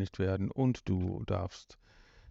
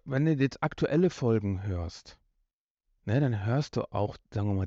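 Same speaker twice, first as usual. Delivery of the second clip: ordinary delivery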